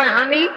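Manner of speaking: হানি with an h sound in place of f; Noakhali pronunciation. Shown as a demonstration. Preacher mimicking Noakhali speech, h for f. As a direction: say হানি with a breathy h at the start. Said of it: "হ উচ্চারণ করে".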